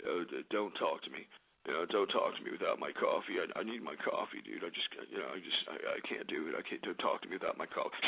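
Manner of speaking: very low, soft, mumbling mock voice; deep groggy mumble parodying the coffee crowd; mocking whiny coffee addict. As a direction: sneer it out like a groggy whiner. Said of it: "I hear these stupid scumbags that say".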